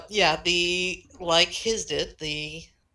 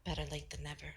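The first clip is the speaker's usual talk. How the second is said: soft kind voice fading to a whisper; gentle whisper; 11 dB quieter, faster than usual. Acted. Eve greeting shy Maris, gently whispered. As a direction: welcome the little one tenderly.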